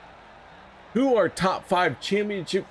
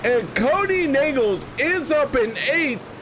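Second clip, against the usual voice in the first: excited race call; 7 semitones higher than usual. Real, not acted. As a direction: call the win at the line. Race finish call over music.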